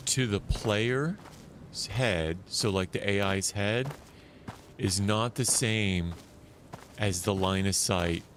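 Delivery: normal talking voice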